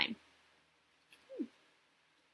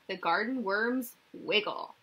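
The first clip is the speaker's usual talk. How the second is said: ordinary talk, nothing marked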